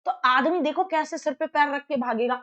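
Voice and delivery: ordinary talk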